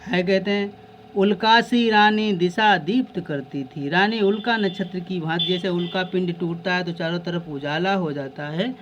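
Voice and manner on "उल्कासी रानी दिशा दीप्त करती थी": poem recitation. Acted.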